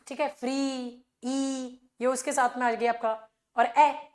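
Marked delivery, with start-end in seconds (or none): 0.3-1.8 फ्री, ई with a long, unchanging 'ee'; long vowel. Shown as a demonstration. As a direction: hold the vowel steady in फ्री, then ई alone. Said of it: "ये लंबा vowel है". (फ्री, ई में लंबा सीधा 'ई' स्वर)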